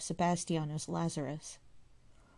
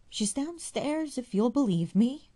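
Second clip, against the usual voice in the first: crying out; 8 semitones higher, 7 dB louder than usual. A narrator's reading. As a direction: call out in dismay. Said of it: "cried the widow".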